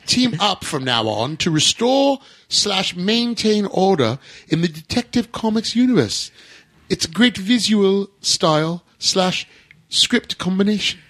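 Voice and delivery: high, slow mock-posh British; hammed-up, drawn-out British toff accent; horrible British accent. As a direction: read it out as a badly faked English toff. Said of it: "I apologize for that horrible British accent".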